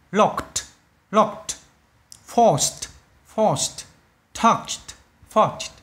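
"Locked", "forced", "touched" each ending in a crisp T; final T sound. Shown as a demonstration. Teacher drills students on -ed, "Locked", "forced", "touched" ending in T. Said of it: "E D được phát âm là T".

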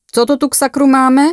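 loud, high, in charge; authoritative shout. A narrator's reading. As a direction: demand answers as the boss arriving on scene. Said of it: "křikl autoritativně muž v civilu".

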